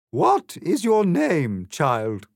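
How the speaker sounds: high, loud and slow regal Queen; imperious demand. A narrator's reading. Queen glares down at Alice and demands her name, imperious. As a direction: demand it from above, haughtily. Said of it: "then demanded".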